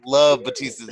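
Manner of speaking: ordinary talk, nothing marked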